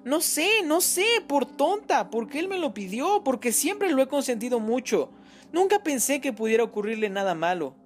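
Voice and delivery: anguished mother. Acted. Mother to the detective, high and anguished. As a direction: cry out the guilt of a spoiled son. Olga blaming herself.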